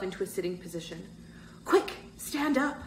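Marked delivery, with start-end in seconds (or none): 1.7-2.8 quick high-pitched order; urgent instruction. A narrator's reading. Boy getting his injured friend to his feet, high urgent order. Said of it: "Bird instructed".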